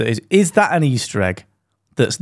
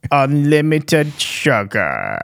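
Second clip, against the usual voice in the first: Palpatine impression; 2 semitones higher, much slower than usual. Mimicking a YouTuber doing Palpatine, slow and sinister. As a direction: ham up a scheming Emperor demanding sugar. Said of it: "did his little voices".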